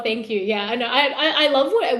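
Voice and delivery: ordinary talk, nothing marked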